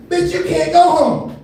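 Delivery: high, drawn-out incredulous retort; comic exasperated outburst; mock scolding.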